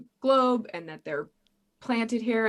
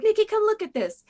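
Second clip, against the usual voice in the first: high, louder call across the room; 14 semitones higher, 6 dB louder than usual. Retold or imitated. Roommate calling Nikki over, high loud call. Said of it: "she was like".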